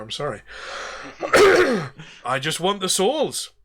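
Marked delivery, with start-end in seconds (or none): 0.5-2.0 high rough cough to reset the voice; throat-clearing cough. Acted. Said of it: "changed my accent". 2.2-3.5 put-on higher pirate accent, back in character; pirate captain redoing his line; Davy Jones accent restored. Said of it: "I've changed my accent from Davy Jones there".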